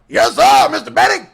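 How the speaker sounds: loud, high mock-subservient voice; hammed-up servant from an old film; old-movie servile caricature. Comic imitating old-show servant answering boss, loud high caricature.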